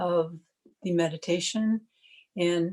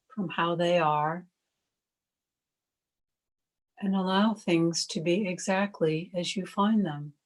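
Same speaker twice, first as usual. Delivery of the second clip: calm, even meditation voice with long pauses; guided meditation. Acted. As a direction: guide listeners gently into stillness, one unhurried phrase at a time.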